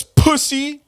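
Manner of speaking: high squeaked name-calling; mock rival insult. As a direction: fling it in his mocking falsetto. Mocking reading of rival's insult.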